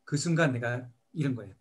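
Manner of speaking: normal talking voice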